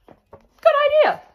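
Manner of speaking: very high, loud, slow and drawn out; parents agreeing. Acted. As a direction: stretch it out in comic delight.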